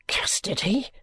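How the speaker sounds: whispered, rising in disbelief; stunned whisper. Acted. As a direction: breathe it out in shock. George stunned at arrest.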